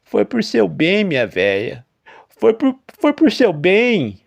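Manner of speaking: high, loud, slow pleading farmer; ashamed old farmer pleading; guilty farmer. A narrator's reading. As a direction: excuse yourself to your dead wife. Farmer answers his wife.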